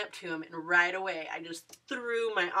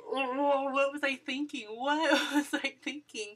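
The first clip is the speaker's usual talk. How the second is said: higher, louder, laughing at yourself; laughing self-scold; 7 semitones higher, 4 dB louder than usual. Retold or imitated. Fan replaying her missed kiss, laughing at herself. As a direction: groan at your own silliness through a laugh. Regrets turning her head.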